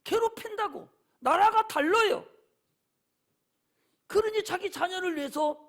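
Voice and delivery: ordinary delivery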